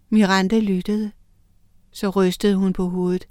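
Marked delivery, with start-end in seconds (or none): none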